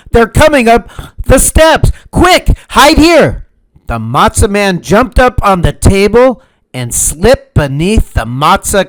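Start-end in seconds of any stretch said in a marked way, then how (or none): 0.1-3.5 high, loud panicked warning; urgent shout. A narrator's reading. Pursuers on the steps.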